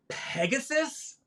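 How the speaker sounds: high, slow, confused question; he's sure he heard it right; puzzled mishearing. Retold or imitated. Director puzzling over the name from the box.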